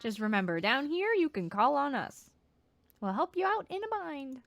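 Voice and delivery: squeaky, loud little leader; chirpy, bossy critter voice; high loud cheerful creature voice. Acted. Chompettes speak again.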